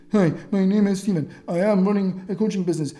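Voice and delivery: mock a stiff scripted salesperson; robotic script reading. Coach mocking someone reading a sales script, robotic. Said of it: "you sound like fucking Stephen Hawking".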